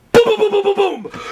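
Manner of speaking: high, shouted booms in quick volley; imitated banging. Storyteller mimicking a tape recorder being punched, imitated banging. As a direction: mimic sudden pounding with a high-pitched yell. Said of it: "suddenly I hear".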